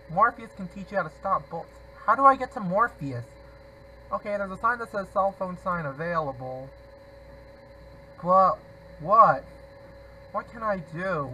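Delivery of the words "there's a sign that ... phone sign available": ordinary delivery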